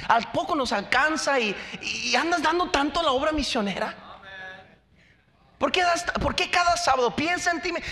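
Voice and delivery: high, rapid, building nagging rant; mock scolding friend against missions; nagging worldly advisor.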